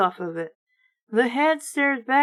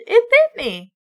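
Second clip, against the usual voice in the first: frightened cry; 11 semitones higher, 6 dB louder than usual. A narrator's reading. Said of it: "she cried".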